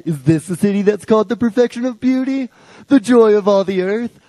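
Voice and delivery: a jeering playground chant of the joy of all the earth; sing-song taunting. Shown as a demonstration. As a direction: tease like a sibling trying to make her sister cry. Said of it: "This is the mocking that you do to your sister to make her cry".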